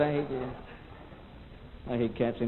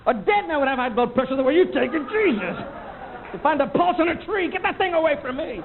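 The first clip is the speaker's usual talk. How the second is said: mock rant; 9 semitones higher than usual. Ranting at the nurse.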